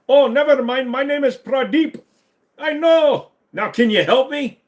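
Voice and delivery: high, loud mock Indian accent, sheepish; hammed-up Indian accent owning up to the name. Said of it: "proper Indian accent".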